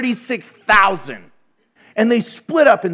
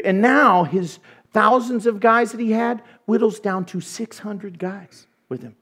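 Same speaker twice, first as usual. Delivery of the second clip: ordinary talk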